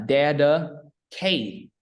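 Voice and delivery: "dedicate" very slowly, weight on the cate syllable; stress on K. Shown as a demonstration. Teacher breaks the word up for learner, stress on K. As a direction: stretch "dedicate" out, leaning on the K sound. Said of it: "And I'm K".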